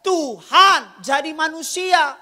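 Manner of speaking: high, loud sermon shout; loud, high-pitched rhetorical preaching; full-force preaching. Real, not acted. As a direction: drive the point home to the congregation.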